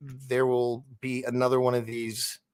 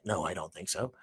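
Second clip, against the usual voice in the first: very soft, low, calm denial; mild soft no; not yelling; 6 semitones lower, 10 dB quieter than usual. Retold or imitated. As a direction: say it quietly, no exclamation. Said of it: "Mister B ain't yelling it".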